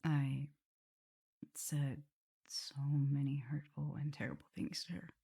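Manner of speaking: soft, ashamed whisper; regretful whisper. Acted. Vampire confesses hurting her mistress, ashamed whisper. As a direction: admit it under your breath.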